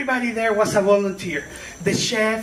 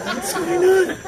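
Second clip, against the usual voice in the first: confused; 7 semitones higher than usual. Retold or imitated. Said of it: "I was like".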